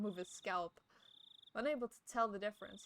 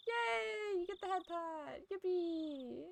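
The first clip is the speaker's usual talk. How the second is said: squealing much higher, cutesy cheer; a giddy little celebration for chat; 9 semitones higher, slower than usual. Acted.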